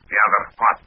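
put-on clipped Russian-accented question. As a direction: play a suspicious Soviet agent. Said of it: "that was me, of course, in my best Russian accent".

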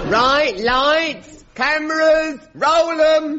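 hammed-up film director cue; director calling action. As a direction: call the shoot with building excitement.

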